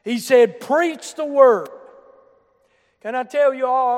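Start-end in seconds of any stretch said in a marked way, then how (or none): 0.6-1.7 "Preach the word" hit hard, louder and higher; slow it down and punch "Preach the word"; emphatic. Retold or imitated. Preacher quoting Paul's charge to Timothy, with heavy emphasis. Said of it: "where Paul put the emphasis".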